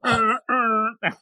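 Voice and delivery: vocal foghorn blasts; imitated tugboat horn. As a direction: do a droning boat horn with your voice.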